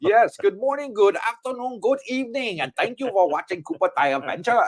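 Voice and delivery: high-pitched mock Thai accent; hammed-up Thai accent, high and sing-song; Katea impression. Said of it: "that was my best Katea impression".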